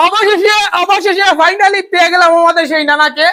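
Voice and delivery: loud, high, slowed showman reveal; grand announcement. Real, not acted.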